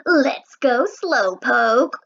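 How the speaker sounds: slow, stretched-out teasing call; drawn-out teasing. A narrator's reading. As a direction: stretch it out to needle him. Brother urging Henry along.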